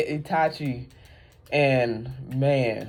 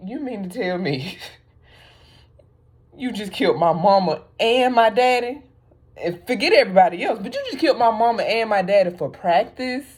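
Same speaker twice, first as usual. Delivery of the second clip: higher, incredulous, laughing accusation; mock outrage; 6 semitones higher than usual. Imagined Sasuke accusing his brother, mock outraged. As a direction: demand an answer in disbelief. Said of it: "I'm sure he was like".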